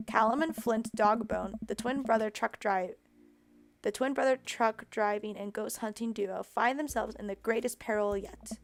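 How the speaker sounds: usual speaking voice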